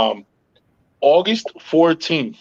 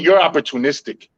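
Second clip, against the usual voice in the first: ordinary delivery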